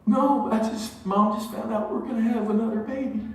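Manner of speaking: high, put-on tearful voice; mock tearful wife. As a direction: imitate a crying mom explaining herself to her child. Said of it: "My wife's crying".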